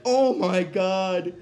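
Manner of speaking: awestruck, very drawn-out exclamation; Fry. Retold or imitated. Friend seeing what the opened screen reveals, slow theatrical amazement. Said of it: "then Fry's like".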